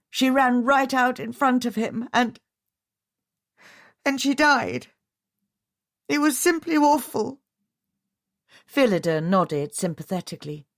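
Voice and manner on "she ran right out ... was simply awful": distressed. A narrator's reading. Widow recalling fatal accident.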